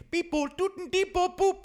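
very high electronic beeping noises; robot beeps. Acted. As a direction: beep like a toy robot powering up. Imitating a robot starting up.

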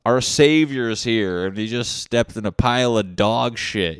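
loud mocking drunk heckler; drunk dude. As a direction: jeer it like a rowdy drunk. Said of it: "some fucking drunk dude's like".

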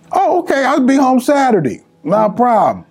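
high-pitched, agreeable retold reply; acting out an obliging son on the phone; breezy son's reply. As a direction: toss it off high and easy. Son breezily answering mother's request.

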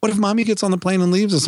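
put-on high pleading child's question; a touch of a panicky kid voice; worried child. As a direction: sound like a scared little girl. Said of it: "daughter's worry retold".

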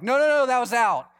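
mock indignant tennis opponent; mock protest. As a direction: argue the call back, half-playful. Opponent disputes a line call.

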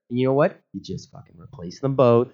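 ordinary talk

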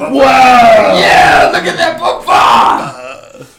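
very high, loud, stretched-out shout; hyped ad announcer. Pretend. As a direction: scream it like a cheesy TV ad. Hammed-up ad for Boomfa.

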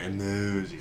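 "emojis" savored, with a long middle syllable; drawn-out emojis. Pretend. Correcting a friend playfully, "emojis" drawn out. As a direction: stretch "emojis" as if it were something special. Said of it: "They're not just emojis".

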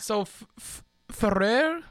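"Ferrer" tried with a rolled double r; careful trilled r in "Ferrer"; rolled r exercise. Shown as a demonstration. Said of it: "is this a rolled R?".

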